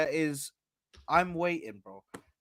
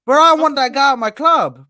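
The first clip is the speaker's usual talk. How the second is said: loud, high mock enthusiasm; fake-gush, loud and high; sarcastic; 10 semitones higher, 10 dB louder than usual. Real, not acted. Mocking his co-host's claim, loud sarcastic gush. Sarcastic denial about Zinchenko.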